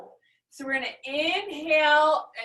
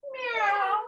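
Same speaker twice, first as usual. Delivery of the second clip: a playful meow, pitched up; high cat meow; 9 semitones higher than usual. Shown as a demonstration. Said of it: "Exhale, cat".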